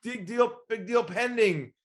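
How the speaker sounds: hammed-up salesman cheering a lead; excited. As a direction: celebrate the call like a giddy rookie. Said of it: "You're so excited you get that call".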